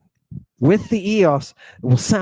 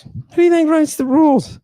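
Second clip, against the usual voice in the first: mocking high voice; 13 semitones higher, 3 dB louder, faster than usual. Rhetorical question, repeated mocking.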